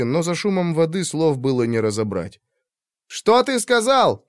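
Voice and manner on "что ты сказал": raised, slow shout. A narrator's reading. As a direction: shout it over the roar of water. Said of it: "закричал Кип".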